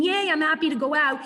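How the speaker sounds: dog demanding its walk right now, high and impatient. Retold or imitated. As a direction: play an impatient dog barking orders. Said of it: "There's a big difference between".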